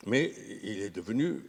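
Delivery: ordinary talk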